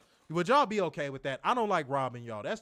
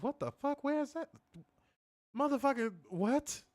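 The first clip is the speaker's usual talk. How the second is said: high, soft incredulous squeak; stunned, can't place the town; quiet high-pitched disbelief; baffled disbelief; 9 semitones higher, 4 dB quieter than usual. Real, not acted.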